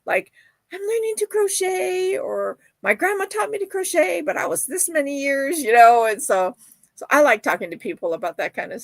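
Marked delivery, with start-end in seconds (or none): 0.7-5.6 imitating young folks. Imitating young people talking.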